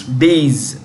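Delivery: days drawn out as one syllable; pronunciation model. Shown as a demonstration. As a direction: say days slowly for learners to copy. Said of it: "Say that".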